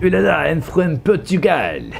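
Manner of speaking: high, hammed-up French accent. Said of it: "I will do it in the French accent".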